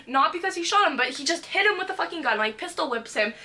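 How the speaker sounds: usual speaking voice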